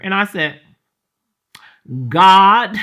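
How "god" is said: louder, a sharp fed-up "God"; the annoyed cry that started it all; exasperated call to God. Retold or imitated. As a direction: bark "God" out in irritation. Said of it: "that's how".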